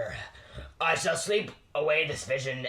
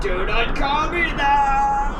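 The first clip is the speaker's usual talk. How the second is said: slow, much higher and louder outraged shout; indignant shout; 11 semitones higher, 7 dB louder, much slower than usual. Acted. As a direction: snap at the ghost for the nickname. Offended by nickname.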